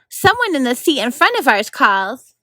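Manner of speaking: plain reading voice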